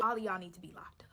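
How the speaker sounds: unvoiced hushed whisper; whispered mad aside. Real, not acted. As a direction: hiss it under your breath. Angry aside.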